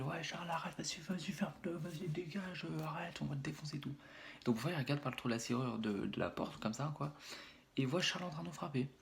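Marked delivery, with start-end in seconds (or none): 0.0-3.9 high, softened pretend shouting; mock yelling. Brother overhears the kids telling Charles to stop, mock yelling. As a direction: imitate kids yelling, but keep the volume down. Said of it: "il entend qu'on gueule".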